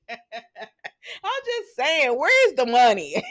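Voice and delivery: helpless laughter through the words, stretched out; laughing. Real, not acted. Vlogger mocking a stingy payoff, laughing. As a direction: crack up while making the point. Cracking up over the small offer.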